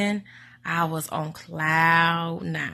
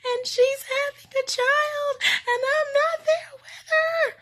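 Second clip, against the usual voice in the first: high, tearful wailing voice; mock-crying mother, far away; imitating a crying mom. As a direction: wail it like a heartbroken mom. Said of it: "my mom was crying".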